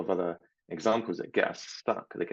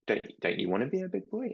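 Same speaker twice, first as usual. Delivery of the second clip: put-on much higher voice, as to a small child; lightly acted, puzzled parental coaxing; 12 semitones higher than usual. Parent to sad son about growing up, high coaxing question.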